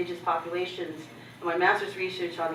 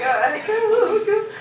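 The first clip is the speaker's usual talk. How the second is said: stunned; 8 dB louder, much slower than usual. Retold or imitated. Stunned reply to his order.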